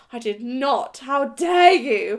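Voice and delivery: retold indignant protest, rising to a loud, slow How dare you; indignant outrage. To her date, caught weeing, indignant loud protest. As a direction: act out the offended denial.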